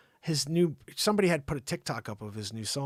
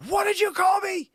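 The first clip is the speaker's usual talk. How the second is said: very high, loud outraged shriek; hammed-up piercing indignation; mock scream; 7 dB louder than usual. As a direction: imitate a woman screaming in offence. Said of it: "she screams at him".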